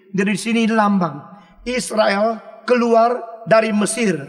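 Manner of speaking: usual speaking voice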